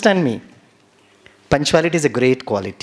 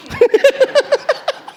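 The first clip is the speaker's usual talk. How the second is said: loud laugh; 3 dB louder than usual. Real, not acted. Speaker laughs at own joke.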